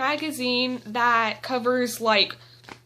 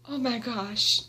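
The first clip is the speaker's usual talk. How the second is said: normal talking voice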